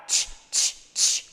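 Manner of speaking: "취" as pure air, no vowel; whispered "ch" for "취", three short bursts; no vocal cords. Shown as a demonstration. Teacher demonstrating no vibration, voiceless "취". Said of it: "올리면 안 돼요".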